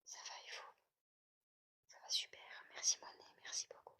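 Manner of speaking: hushed whispered thanks; whispering. Real, not acted. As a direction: whisper so the house stays quiet. Whispered replies to chat.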